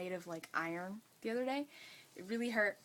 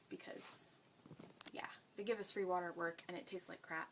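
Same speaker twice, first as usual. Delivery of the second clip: whispering; 3 semitones lower, 6 dB quieter than usual. Real, not acted. Aside about work water.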